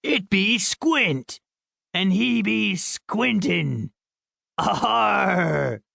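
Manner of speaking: high, drawn-out hammed-up pirate; slow pirate growl rising to a big "arr". A narrator's reading. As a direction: stretch every word like a teasing pirate. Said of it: "used his best pirate voice".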